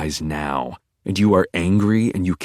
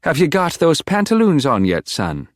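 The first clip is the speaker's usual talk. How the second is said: put-on high, lilting Irish accent, dropping at the end; Irish shopkeeper; 9 semitones higher, 3 dB louder than usual. A narrator's reading. Irish shopkeeper calling to a customer in the dressing room, high Irish lilt. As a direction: call out as a chirpy Irish shopkeeper. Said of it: "the well-intentioned Irish shopkeeper prattles on".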